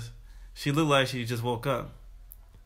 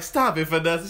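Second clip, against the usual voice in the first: aunt voice; 4 semitones higher than usual. Retold or imitated. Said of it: "'Cause Denise was like".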